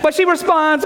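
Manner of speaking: usual speaking voice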